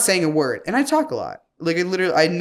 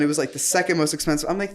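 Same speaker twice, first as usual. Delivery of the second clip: usual speaking voice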